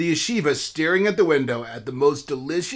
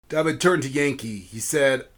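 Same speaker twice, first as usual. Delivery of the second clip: plain reading voice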